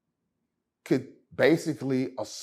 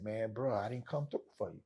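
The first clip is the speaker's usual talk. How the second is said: softer, quick, dejected apology; sad apology; 3 semitones lower, 10 dB quieter than usual. Retold or imitated. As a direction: mumble it like you let him down. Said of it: "depressed, like, sad".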